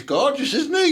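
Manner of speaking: high doting coo over the dog; high pet-talk coo. Real, not acted. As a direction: gush over him in a high voice. Cooing over the dog picture.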